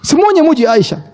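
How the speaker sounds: first take pitched well up and pushed; emphatic high. Shown as a demonstration. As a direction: announce it high like big news. Preacher repeats key line for emphasis.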